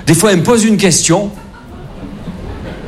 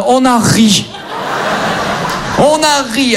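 laughing; 8 semitones higher, slower than usual. Acted. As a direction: laugh it out while retelling. Retelling father's fall into pool.

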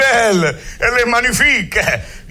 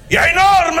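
"énorme" shouted high and loud; stress on énorme; 9 semitones higher, 4 dB louder, faster than usual. Acted. Doctor proudly shouts "énorme" about himself. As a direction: punch the word "énorme". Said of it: "Et dans énormément".

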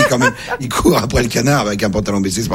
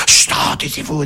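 whispered "chut, taisez-vous"; shushing whisper; 6 semitones higher than usual. Retold or imitated. Spectator hushing the crowd in the dark theatre, whispered. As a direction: hush the noisy neighbour.

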